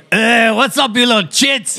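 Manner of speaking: high gruff mock greeting; gruff uncle. Uncle greeting kids from the car, mock gruff. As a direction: bark it out like a crude uncle. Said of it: "and he goes".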